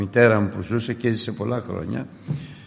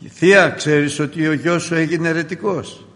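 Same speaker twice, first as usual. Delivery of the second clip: raised, louder tattling voice; tattling cousin; 6 semitones higher, 6 dB louder than usual. Retold or imitated. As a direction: tell on him to his aunt, pitched up. Cousin tells on him. (ξάδερφος που καρφώνει στη θεία, δυνατά)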